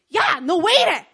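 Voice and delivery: high, loud boss yelling across the office. Retold or imitated. Boss yelling at the intern. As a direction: bark it like an angry boss.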